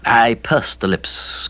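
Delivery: ordinary delivery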